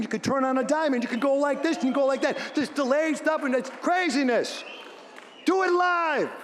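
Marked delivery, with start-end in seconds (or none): 2.6-6.3 rallying shout. Real, not acted. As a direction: fire up the crowd. Rallying cry about live radio.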